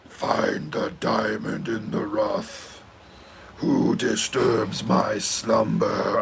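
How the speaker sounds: low, slow rumbling cave voice; grinding stone statue. Retold or imitated. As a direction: whisper it like a looming stone cave. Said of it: "Oh, it's the same fucking voice".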